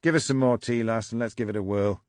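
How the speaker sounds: lightly voiced gruff northern husband; a hint of a bossy husband. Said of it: "he suggested distractedly".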